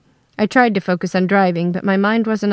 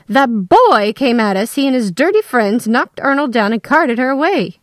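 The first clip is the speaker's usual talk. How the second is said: louder, higher outraged voice; indignant accusation; 4 semitones higher, 4 dB louder than usual. Acted. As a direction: accuse them with disgust.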